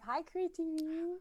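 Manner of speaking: high, drawn-out greeting; slow, high hello to the audience; calling out hello. Real, not acted. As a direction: sing out a hello to the viewers.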